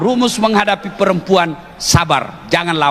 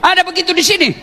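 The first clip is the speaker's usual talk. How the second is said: preaching at full force; 11 semitones higher than usual. Acted. Ustadz fires up the congregation about wives and husbands, at full force. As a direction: preach it hard to the crowd, rising. Preacher rises to fervent pitch.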